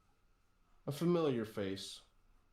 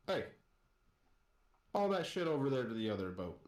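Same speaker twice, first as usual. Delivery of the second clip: pirate captain's brisk, lightly raised call; mildly yelled order; 4 semitones higher, faster than usual. Acted. Pirate captain ordering a captive to haul cargo, mildly yelled. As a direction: bark a quick order, only half yelled. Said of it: "you hear a gentleman yell at you".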